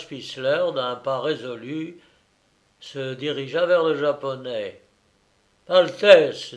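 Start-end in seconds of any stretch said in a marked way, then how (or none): 5.7-6.5 loud, angry address; shaking with anger. A narrator's reading. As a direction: bark the title with anger. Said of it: "d'une voix tremblante de colère".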